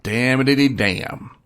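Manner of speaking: loud, high hillbilly cussing; mock angry outburst. Thinking the lady hit his wife's car, mock angry. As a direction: blurt it out loud, hamming up the alarm.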